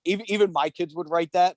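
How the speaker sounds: ordinary talk, nothing marked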